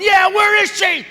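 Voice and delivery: high, drawn-out mock-indignant shout; mother joining the outcry. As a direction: yell along with the crowd as the mother. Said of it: "his mother's standing up going".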